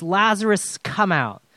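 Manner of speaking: called out loud and high on Lazarus; loud cry. A narrator's reading. Jesus calling Lazarus out of the tomb, a loud cry. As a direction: call him out of the tomb. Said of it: "he cried out with a loud voice".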